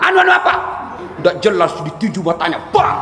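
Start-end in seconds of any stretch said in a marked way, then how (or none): 0.0-0.5 snapped, higher and louder "Anu, anu apa?"; angry demand. Acted. Angel grilling the stingy boss, angry demand. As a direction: push him hard for an answer.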